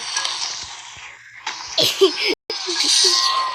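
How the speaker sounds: loud shouted laugh, high; loud burst of laughter. Real, not acted. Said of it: "I can't stop laughing".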